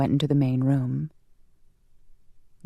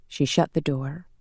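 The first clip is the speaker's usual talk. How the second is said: ordinary delivery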